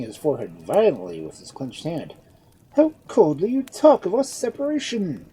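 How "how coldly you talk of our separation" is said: loud, high reproach; reproachful outburst. A narrator's reading. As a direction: fling it at her, hurt. Edmund wounded by her coldness.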